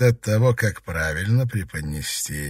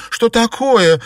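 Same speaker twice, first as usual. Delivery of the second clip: high, loud startled question; anxious outcry; alarmed; 9 dB louder, slower than usual. A narrator's reading. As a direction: demand it in sudden alarm. Girl alarmed by coming news.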